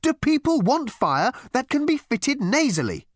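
high, earnest insistence; insistent. A narrator's reading. Marketing girl raising research question, insistent. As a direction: put the question pressingly. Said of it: "insisted the girl".

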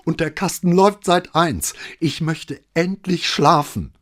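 high, pleading wail, slowing at the end; near tears. A narrator's reading. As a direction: beg for sleep, almost crying. Sleepless neighbour pleads near tears.

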